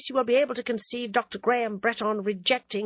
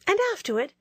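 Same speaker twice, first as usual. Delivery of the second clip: 1 Ginevra asking; 8 semitones higher, much faster than usual. Acted. Ginevra voiced by narrator.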